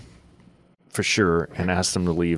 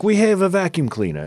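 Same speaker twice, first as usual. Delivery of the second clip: pushy salesman; 11 semitones higher than usual. Retold or imitated.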